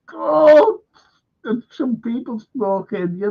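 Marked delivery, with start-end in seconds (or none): 0.1-0.8 high, loud mock cry of shock; retold shocked exclamation. Telling the freezer scene, mock shocked cry.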